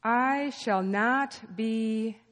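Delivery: very slow reading with long pauses; slow scripture reading. Shown as a demonstration. Preacher reading the psalm for congregation to interrupt, very slowly. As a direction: leave space between phrases for interruptions. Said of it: "I'm gonna read it slowly".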